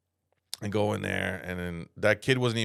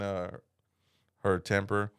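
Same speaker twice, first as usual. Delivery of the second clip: normal talking voice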